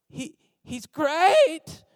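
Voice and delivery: GREAT stretched out high and loud, pitch sliding up; insincere praise inflection. Retold or imitated. Reference hedging about someone to the pastor, insincere high GREAT. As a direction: draw out GREAT in a high, loud, doubtful mock endorsement. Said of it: "you get the inflection".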